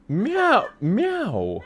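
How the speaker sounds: imitated meow, falling in pitch; cat meow. Streamer playfully meowing, cat imitation. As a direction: meow like a cat, drawn out and sliding down.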